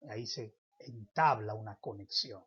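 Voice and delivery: ordinary talk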